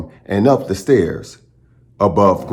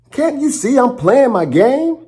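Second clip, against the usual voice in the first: much higher, snippy sister voice; annoyed big sister. Acted. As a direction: brush off the little brother, irritated. Sister annoyed, shooing Milo away.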